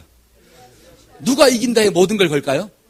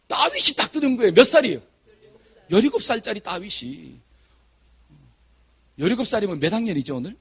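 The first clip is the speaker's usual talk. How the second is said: ordinary talk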